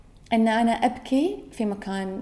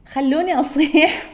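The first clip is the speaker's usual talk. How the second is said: loud pleading; 5 semitones higher, 6 dB louder than usual. Real, not acted. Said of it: "pleading to be allowed to cry".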